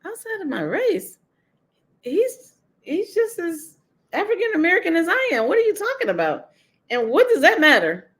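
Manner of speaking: high, incredulous push-back at the critics; indignant retort. Retold or imitated. Retelling her retort to critics of her relationship, indignant and high. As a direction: scoff at the idea like it's absurd. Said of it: "And I said".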